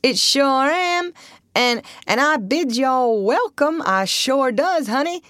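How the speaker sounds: high, slow dialect voice; old Southern boatman, welcoming. Acted. Old boatman welcoming strangers to his cabin, slow drawl. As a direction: draw it out slowly in a Southern drawl.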